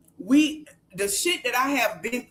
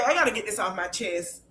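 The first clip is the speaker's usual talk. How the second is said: usual speaking voice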